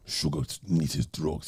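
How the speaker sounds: put-on low, soft Jamaican accent. Quoting a Jamaican character from Top Boy, low Jamaican accent. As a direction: drop down into a quiet Jamaican voice. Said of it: "He was a Jamaican guy".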